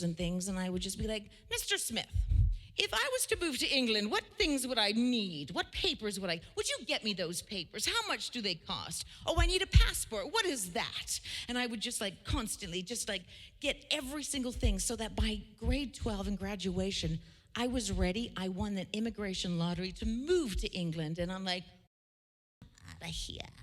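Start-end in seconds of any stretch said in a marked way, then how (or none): 1.5-11.2 put-on high-pitched, eager kid pestering a grown-up; eager kid questioning. Retells pestering a friend's parent as child. 22.9-23.5 whispered sign-off; soft, breath-only aside; hushed exit line. Retold or imitated. Retells her triumphant exit, hushed.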